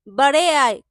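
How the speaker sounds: loud, scornful outburst; indignant sneer at a cheeky beggar; scornful jibe. A narrator's reading. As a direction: throw it out loudly in contempt. Wife sneers in her thoughts.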